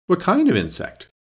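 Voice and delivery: high, slightly anxious question; a little anxious. Acted. Alice asks the hidden voice, a little anxious. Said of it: "Alice inquired a little anxiously".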